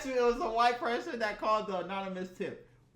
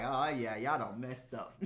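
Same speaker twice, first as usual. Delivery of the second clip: sinister gloat; 8 semitones lower, 5 dB quieter than usual. Pretend. Imagined gloating at cameras.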